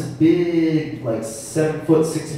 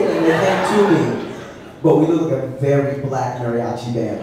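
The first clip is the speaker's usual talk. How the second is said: usual speaking voice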